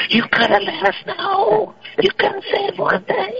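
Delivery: high, slow whiny complaint; hammed-up wounded whine; mock whine. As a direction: whine like a hurt friend.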